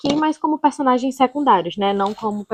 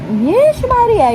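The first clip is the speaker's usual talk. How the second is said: surprised exclamation; 12 semitones higher, 5 dB louder, much slower than usual. Real, not acted. Startled by dogs crying.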